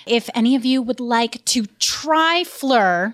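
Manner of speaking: slow upbeat ad read. Acted. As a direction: read the sponsor spot slowly. Podcast ad read.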